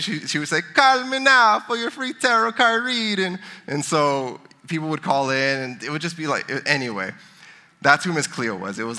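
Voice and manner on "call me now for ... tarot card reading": high, slow imitation of a Jamaican fortune-teller ad; mock Jamaican accent. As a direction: put on a hammed-up Jamaican accent like a late-night psychic ad. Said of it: "she had this Jamaican accent".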